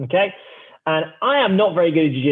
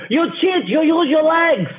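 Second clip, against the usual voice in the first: mocking the Russian guy; 10 semitones higher, slower than usual. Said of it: "And he's like".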